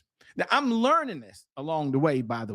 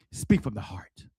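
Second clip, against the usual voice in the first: put-on a lower, hushed voice of the elderly mentor; old mentor; 10 semitones lower than usual. Elderly mentor advising a young speaker, lowered hushed voice. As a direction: lower your voice like the wise old man advising. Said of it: "He said".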